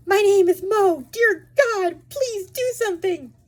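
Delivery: high, loud, desperate plea; terrified pleading. Acted. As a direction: beg for help in panic.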